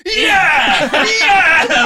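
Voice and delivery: high, loud mock cheers; cheering. Man imitating a control room celebrating, loud cheering. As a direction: whoop like mission control after re-entry. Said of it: "They're all, like, taking off headsets".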